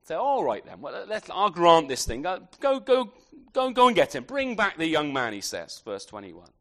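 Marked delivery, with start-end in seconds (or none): 0.2-5.3 loud, impulsive acted consent; softened king relenting. David granting the request to fetch his son, loud acted relenting. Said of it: "it's just softened him enough, hasn't it, to, to sort of say".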